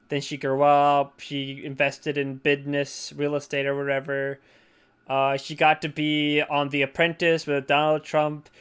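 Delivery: ordinary talk, nothing marked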